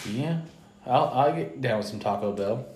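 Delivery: ordinary delivery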